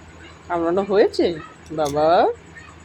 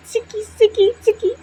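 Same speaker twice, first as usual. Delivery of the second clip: high playful call; 11 semitones higher, 3 dB louder than usual. Acted. Calling pet name playfully.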